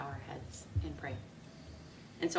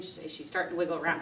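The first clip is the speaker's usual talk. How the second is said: normal talking voice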